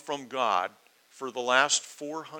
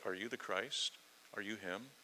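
softer, rising "Are you the Christ? Are you him?"; hushed hopeful question; 3 semitones lower, 13 dB quieter than usual. Retold or imitated. Preacher voicing priests' real hidden question, hushed and rising. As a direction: drop the voice and lift "Christ" and "him" at the end, hopeful. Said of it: "their intonation and what was the true".

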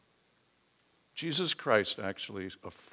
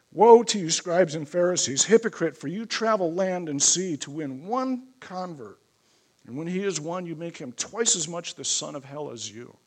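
loud, raised opening woe, then driving on; forceful scripture preaching; 6 semitones higher, 8 dB louder than usual. Acted. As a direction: proclaim the rebuke from scripture.

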